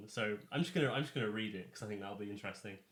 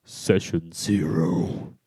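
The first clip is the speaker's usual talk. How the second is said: slow, loud, drawn-out proclamation; dramatic announcement; 4 semitones lower, 17 dB louder, much slower than usual. Acted. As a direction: boom it out like a title card.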